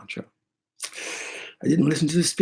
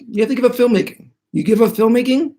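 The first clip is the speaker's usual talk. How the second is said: high, quick imitation of a spirit; raised-pitch bargaining spirit; spirit's demanding voice; 10 semitones higher, 3 dB louder, faster than usual. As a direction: lay down the spirit's terms, pitched up and fast.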